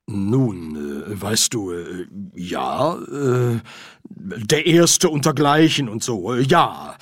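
stumbling and hesitant, full of ums; a nervous archchancellor fumbling for words; unsure. Acted. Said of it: "antwortete Ridcully unsicher".

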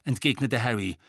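usual speaking voice

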